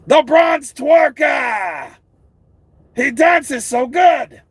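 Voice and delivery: high, slow taunting yell; mocking shout. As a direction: drag out the taunt loudly.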